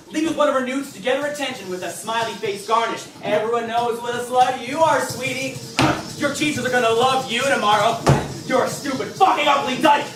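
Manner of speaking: gloating abuse. Acted. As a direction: taunt her through the screen. Typing abusive messages.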